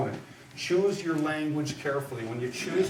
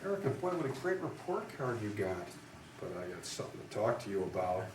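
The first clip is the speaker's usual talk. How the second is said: soft, sweet mom voice turning low; a hint of a mother easing into bad news; lightly voiced mom; 4 semitones lower, 8 dB quieter than usual. To Erica, a mom's gentle buildup to a scolding. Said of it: "you come home and she says".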